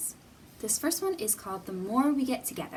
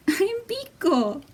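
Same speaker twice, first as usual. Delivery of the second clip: higher, louder little creature voice; character voice; 10 semitones higher, 9 dB louder, slower than usual. Acted. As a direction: pipe up as the cheerful imaginary friend. Character introduces itself in story.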